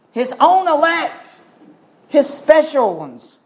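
high, ringing preacher's cry; fiery preaching. Acted. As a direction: preach it out to the whole congregation. Preaching at full force, high and loud.

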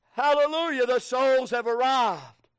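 rejoice out loud over the souls; joyful exclamation. Real, not acted.

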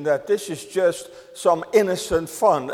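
ordinary talk, nothing marked

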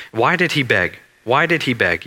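ordinary delivery

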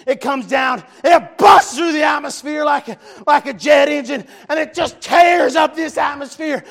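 loud, fervent revival preaching; impassioned sermon delivery; preaching at full force. Real, not acted. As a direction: preach it to the rafters, building to shouts. Preacher reading and preaching Revelation.

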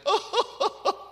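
high, hammed-up sobbing wail; exaggerated laughing-crying outburst; mock relieved weeping. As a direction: blubber with comic relief. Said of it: "why Jacob was weeping".

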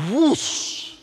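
loud, high whoosh sound effect; sudden whoosh. Pretend. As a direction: burst out a rushing whoosh. Angel appears suddenly.

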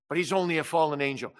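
usual speaking voice

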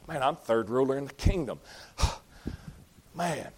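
softly sighed, savouring the new rank; imagined self-satisfaction. Retold or imitated. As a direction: act out quiet self-satisfaction with a breathy sigh. Imagined Daniel savouring his promotion.

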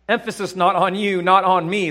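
ordinary delivery

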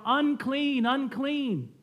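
high, drawn-out cry of warning; mock crying out. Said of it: "and cry out".

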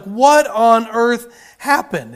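slow, loud alarmed question; drawn-out shocked what on earth; shocked wife. Retold or imitated. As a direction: gasp it out like a startled wife. Wife shocked at bruised husband.

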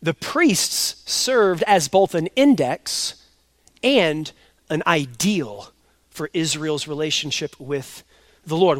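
slow, deliberate repeat with index and ideal weighted; slow restatement. Shown as a demonstration. Pastor repeating Miller's point slowly for his church. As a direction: spell it out slowly so it sinks in. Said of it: "Let me say that again".

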